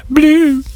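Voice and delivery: high, chirpy robot bloop noises; playful high-pitched robot beeps. Acted. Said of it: "I make random robot noises".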